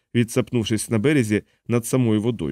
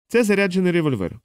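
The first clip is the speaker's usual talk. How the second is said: higher-pitched character line; lightly acted, pitched-up statement; lightly voiced negotiator; 8 semitones higher than usual. A narrator's reading. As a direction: lift the pitch as if addressing someone across. Moro calls out to Prendick.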